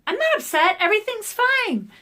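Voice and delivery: high-pitched, overbright mock composure; imitated forced "all is well" voice; pretending to be fine. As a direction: act out covering up tears with a loud, high denial. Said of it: "get pulling it together".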